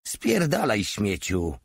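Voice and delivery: usual speaking voice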